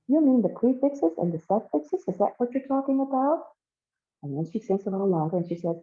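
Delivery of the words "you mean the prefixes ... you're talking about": high, incredulous questioning; puzzled questioning. Acted. Kay thinks about extras, asking in high puzzlement. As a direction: pitch it up as if checking what was meant.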